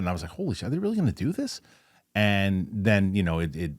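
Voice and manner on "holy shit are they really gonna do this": high, quick, hushed-down shock; retold disbelief. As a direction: replay your stunned reaction, pitched way up. Quotes own shock at rumors.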